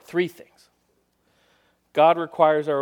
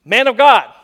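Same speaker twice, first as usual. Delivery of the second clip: loud higher summons; soldiers calling out; 6 semitones higher, 13 dB louder than usual. Acted. Second captain calling to Elijah, loud summons. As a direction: shout up the hill at the prophet. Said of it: "And they say".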